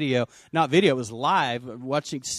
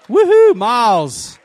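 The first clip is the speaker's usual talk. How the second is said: slow, louder, higher whoop for a friend; cheering; 8 semitones higher, 12 dB louder, slower than usual. Real, not acted. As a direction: greet him with a joyful whoop. Cheering a returning congregant.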